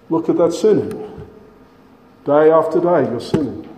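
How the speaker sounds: ordinary talk, nothing marked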